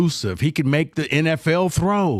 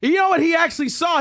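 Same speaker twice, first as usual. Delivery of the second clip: ordinary talk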